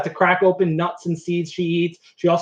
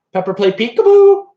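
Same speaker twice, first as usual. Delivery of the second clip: louder call to the bird; calling to parrot; 6 semitones higher, 7 dB louder than usual. Real, not acted.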